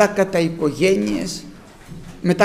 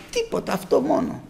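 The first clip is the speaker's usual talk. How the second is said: ordinary talk, nothing marked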